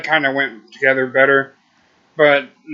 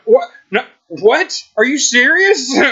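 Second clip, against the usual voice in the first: shocked disbelief; 11 semitones higher, 4 dB louder than usual. Retold or imitated. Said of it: "we're like".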